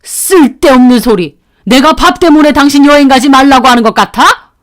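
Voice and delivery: father-in-law angrily rebuking his wife at the table; angry retort. Acted. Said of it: "아버님은 어머님을 노려보시더라고요".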